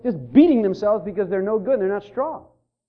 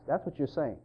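usual speaking voice

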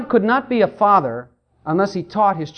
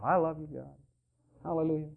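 mumbled, much softer, reluctant "I love you, God"; half-hearted praise; 5 semitones lower, 15 dB quieter, faster than usual. Shown as a demonstration. Preacher mocks the self-conscious worshipper, soft and reluctant. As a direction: say it like you're embarrassed to. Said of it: "God wants you to do it with all your heart".

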